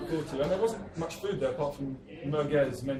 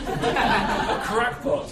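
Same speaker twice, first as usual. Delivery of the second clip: very slow, high, louder laughing cackle; cackling; 7 semitones higher, 6 dB louder, much slower than usual. Acted. Baron relishing his madness with a drawn-out high cackle. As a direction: stretch the word out in a mad cackle. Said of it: "he cackled".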